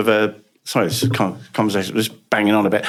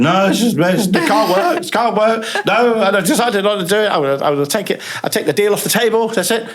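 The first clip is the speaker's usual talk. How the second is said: acted-out upset; 9 semitones higher than usual. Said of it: "I'd act out".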